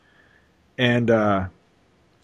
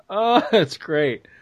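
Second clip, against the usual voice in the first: normal talking voice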